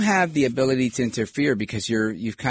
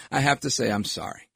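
normal talking voice

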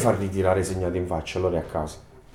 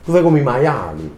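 ordinary talk, nothing marked

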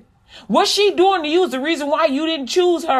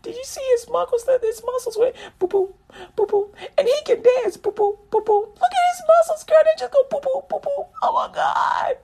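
gushing in a much higher voice, ending in a shriek; a giddy crush, breathless over his muscles; starts hushed, climbs to a squealing oh my God; swooning woman; 10 semitones higher, 3 dB quieter than usual. Retold or imitated. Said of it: "and you be like".

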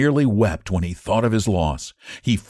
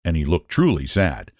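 usual speaking voice

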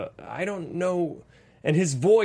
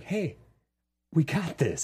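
softer, soothing hey we got this; calm reassurance; 2 semitones higher, 4 dB quieter than usual. Retold or imitated. Wife calming her panicking husband, soft reassurance. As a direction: settle him down gently. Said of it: "my wife's like".

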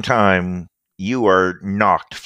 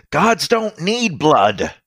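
drugged villain; 6 semitones higher, slower than usual. Acted.

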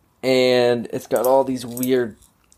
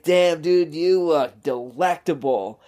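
flirty come-on; 2 semitones higher, slower than usual. Retold or imitated. Flirting with stranger in fries floaty.

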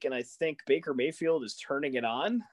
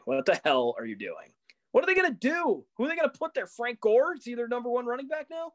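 exasperated, much higher questions; getting pissed; 9 semitones higher than usual. Real, not acted. Podcast host venting at the Jets, exasperated and high. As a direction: fire off the questions in real disbelief. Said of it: "I'm getting pissed".

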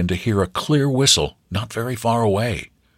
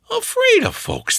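put-on high, thin old farm grandmother; high-pitched old woman. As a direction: squeak it out like a country granny. Grandmother voiced in story.

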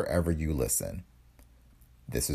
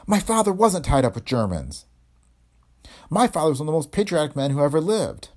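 loud, higher-pitched protest; indignant; 9 semitones higher, 4 dB louder than usual. Acted. Daughter defending her father's patriotism, indignantly. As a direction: defend him hotly, pitch raised.

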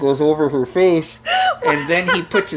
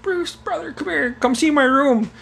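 higher-pitched imitation of an eager sister; sister calling out; 12 semitones higher than usual. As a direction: call him over in a lifted voice. Young sister calls brother over.

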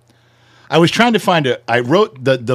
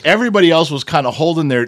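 ordinary talk

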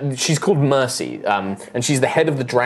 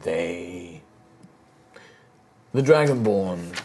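put-on an old emperor's spirit recounting dark history; grave ancient spirit; 5 semitones lower, 7 dB quieter than usual. GM voices Callus telling lore.

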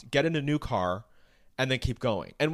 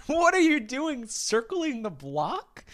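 laughing disbelief; 12 semitones higher, much slower than usual. Real, not acted. Incredulous at the story.

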